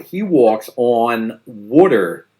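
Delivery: put-on "walks on water" said slowly in a Philly accent, "water" as wooder. A Philadelphian praising Lawrence Brown, Philly accent. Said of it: "the vowel sound in the word on is more like on".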